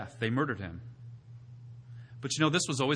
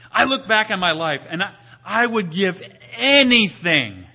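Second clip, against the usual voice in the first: louder, higher, slow hammering preacher; preaching at full force; 5 semitones higher, 9 dB louder, slower than usual. Acted. Pastor building to his point, preaching hard. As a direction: drive each clause home to the pews. Climax of the sermon.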